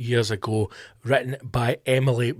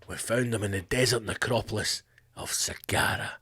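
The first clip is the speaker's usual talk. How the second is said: dramatic Hollywood trailer voice; 6 semitones lower, 10 dB quieter than usual. Shown as a demonstration. Archaeologist answering a reporter, in a low, soft trailer voice. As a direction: give it that epic movie-trailer hush. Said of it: "It just sounds Hollywood".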